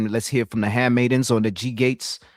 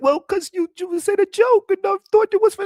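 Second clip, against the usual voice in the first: much higher nervous little voice; mock scared reply; 6 dB louder than usual. Goodfellas guy timidly answering Pesci, mock nervous high voice. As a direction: answer like you're terrified of him. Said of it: "he got all scared".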